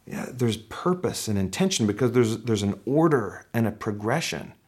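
ordinary talk

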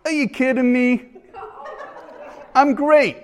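high-pitched attempt at a New York accent; playful, amateur New York accent impression; [name]'s New York accent. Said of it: "I will try to do the New York accent".

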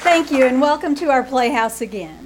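ordinary delivery

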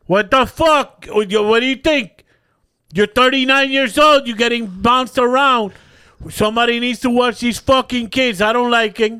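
put-on dad yelling that someone has to watch the kids; angry dad. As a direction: rant at your grown son like a furious dad. Dad refuses doctor trip, angry.

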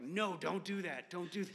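retold pleading protest. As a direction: act out trying to stop someone. Recalls begging wife to stop.

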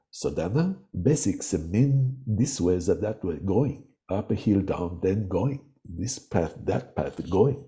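lightly imitating a Tibetan teacher's heavy Tibetan accent; broken English in a light Tibetan accent. Narrator imitates the Tibetan Rinpoche teaching, light Tibetan accent. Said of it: "his English had such a heavy accent".

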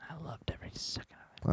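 breathy fast whisper. Real, not acted. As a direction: whisper it like a confession. Confessing guilty pleasure, whispered.

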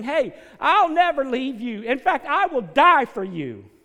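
loud, rising pulpit preaching; preaching at full force. Acted. Pastor preaching hard to his congregation, loud and rising. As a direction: drive it home to the congregation.

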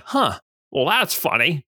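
put-on high drawling old-movie Jimmy Stewart voice; Jimmy Stewart impression. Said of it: "that's his accent".

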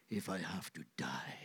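whispered, breathy vow; softly whispered promise; hushed whisper. Acted. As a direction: drop to a whisper before the big line.

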